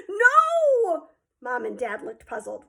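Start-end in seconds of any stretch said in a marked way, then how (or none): 0.1-1.1 high sobbing cry; tearful scream. A narrator's reading. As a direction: wail it through tears. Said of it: "screamed through his tears".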